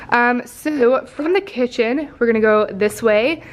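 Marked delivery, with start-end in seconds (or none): none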